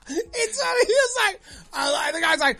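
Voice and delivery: cracking up while telling it; high squeaky laughter through the words; laughing hard. Real, not acted. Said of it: "Oh, shit".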